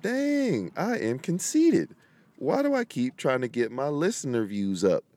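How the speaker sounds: high, mock-shocked "Dang" that settles; mock self-scolding. To himself when his own episode keeps playing, hammed-up mock shock. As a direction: pretend to be appalled at yourself. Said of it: "I'm like".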